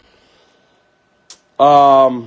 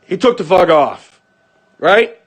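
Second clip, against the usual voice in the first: ordinary talk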